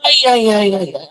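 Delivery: strained groans mid-stretch; groaning while stretching. Real, not acted. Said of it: "I'm stretching".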